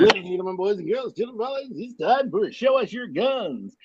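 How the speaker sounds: grand entrance announcement. Acted. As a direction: announce it like a boxing MC. Segment intro announcement.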